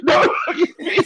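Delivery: high laughing whine; laughing plea. Acted.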